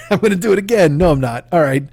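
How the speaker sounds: usual speaking voice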